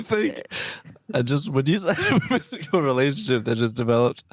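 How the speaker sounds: words broken by laughter; laughing. Real, not acted. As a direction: talk through the giggles. Laughs while retelling line.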